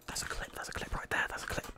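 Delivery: very soft, voiceless whisper; hushed whisper. Real, not acted. Gamer marks a clip after a big play, hushed whisper. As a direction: whisper it in awe.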